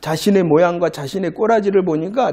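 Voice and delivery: ordinary talk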